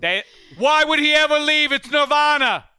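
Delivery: hammed-up New England accent. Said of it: "I can't do a New England accent".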